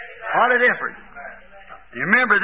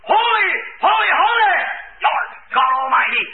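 hollered praise; 6 semitones higher than usual. Retold or imitated. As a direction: shout it out high to the rafters. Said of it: "When he hollered".

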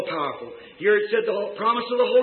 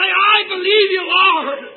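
high, loud, slow cry of faith; loud declaration; 10 semitones higher, 9 dB louder, much slower than usual. Acted. Believer answers the Lord, loud declaration. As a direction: cry it out to heaven.

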